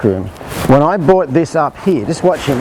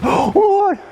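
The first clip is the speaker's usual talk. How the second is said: much higher, startled squeak. Retold or imitated. Rider spots the peacock on the fence, startled high yelp. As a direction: caught off guard, blurt it out high.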